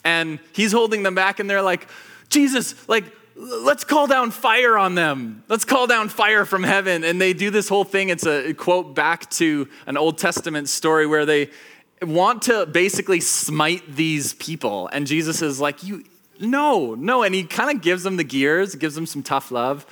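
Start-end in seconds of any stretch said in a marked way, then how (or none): 2.3-7.0 riled-up outburst. Acted. Disciples urge Jesus to burn the Samaritans, riled up. 15.8-17.2 exasperated refusal. Acted. Jesus refusing the disciples.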